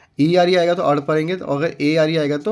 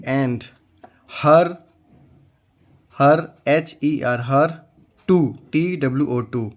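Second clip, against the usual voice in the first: ordinary talk, nothing marked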